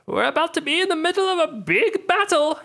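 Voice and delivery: high, strained warning call to the party; party member shouting a warning, high and strained; high character shout. Acted. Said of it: "you shout to others".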